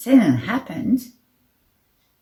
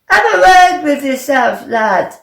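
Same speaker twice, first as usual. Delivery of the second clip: loud, higher-pitched yelled telling-off; yell over the shoulder; 9 semitones higher, 11 dB louder than usual. A narrator's reading. Walking away from a stuck player, yelled back. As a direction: call it back loudly as you walk off. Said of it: "he yelled over his shoulder".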